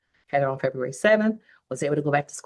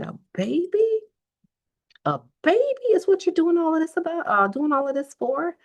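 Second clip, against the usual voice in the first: much higher, incredulous mom voice; mom's scoffing; 12 semitones higher than usual. Retold or imitated. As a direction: scoff at her like a disbelieving mother. Mom scoffs at daughter, retold.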